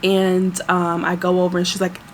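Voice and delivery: usual speaking voice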